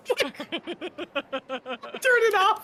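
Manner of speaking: high, laughing plea to stop; frantic hound voice. Retold or imitated. Hound begging its owner to stop the vacuum, high and laughing. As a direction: squeal it like a panicked hound. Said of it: "dog barking, howling at me".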